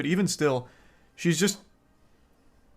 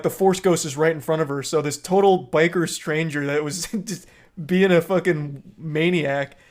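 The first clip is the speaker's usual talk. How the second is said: ordinary delivery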